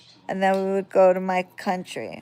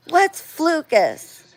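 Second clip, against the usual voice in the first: put-on kid's question; 9 semitones higher, slower than usual.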